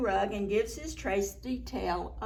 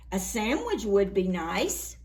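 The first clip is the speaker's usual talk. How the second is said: ordinary delivery, nothing marked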